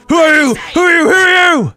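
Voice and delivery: high, loud repeated demand; loud playful confrontation; shouting. Pretend. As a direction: yell it at a stranger. Shouting at game character.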